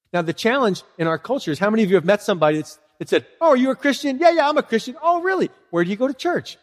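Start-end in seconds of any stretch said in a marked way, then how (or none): 3.4-6.6 higher, lightly acted back-and-forth; animated mock small talk; acted-out casual chat. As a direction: lightly mimic two people chatting in a raised pitch. Acts out chat between two Christians.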